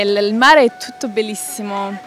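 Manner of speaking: usual speaking voice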